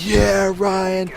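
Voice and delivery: high, held-back crowd cheer; mock cheering for a friend; imitated cheer. Imitates students cheering him.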